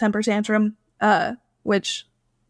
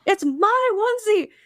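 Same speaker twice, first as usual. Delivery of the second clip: indignant; 9 semitones higher than usual. Retold or imitated. Indignant wife confronting the intruder.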